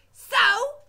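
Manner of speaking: high, loud bark; cook shouting. Acted. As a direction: snap it out angrily. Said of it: "shouted the cook".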